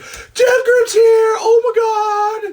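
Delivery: high, loud, drawn-out yell of joy; excited shout. Real, not acted. Host shouting joyfully as the product arrives. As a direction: scream it out like a thrilled fan.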